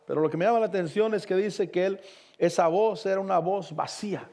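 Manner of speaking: ordinary talk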